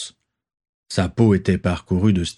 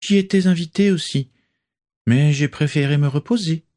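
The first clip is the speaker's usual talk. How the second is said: Narcissa speaking; 8 semitones higher than usual. A narrator's reading. Narcissa voiced.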